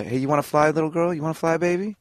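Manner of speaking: put-on high, sing-song talk to a little girl; imitated co-star's improv. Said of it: "Fifth actually improv'd".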